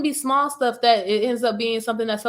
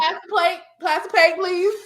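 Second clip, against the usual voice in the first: mock preacher; 8 semitones higher than usual. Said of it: "like a youth pastor".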